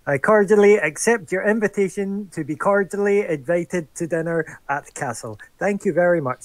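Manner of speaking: chest puffed out, grand and formal; overly formal, high and loud; pompous puffed-up voice. Acted. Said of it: "puff up my chest and be like".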